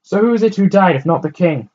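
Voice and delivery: loud, high question thrown down; calling down. A narrator's reading. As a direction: shout down to the street. Woman calling down.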